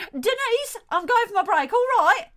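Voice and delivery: high, loud holler to an assistant; calling out. Acted. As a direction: shout orders across the salon. Salon owner calls to assistant.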